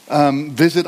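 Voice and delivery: usual speaking voice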